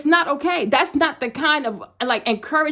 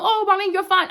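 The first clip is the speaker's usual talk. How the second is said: higher, sing-song mock of a friend's reassurance; 6 semitones higher than usual. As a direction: lightly imitate a friend brushing it off. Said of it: "don't tell".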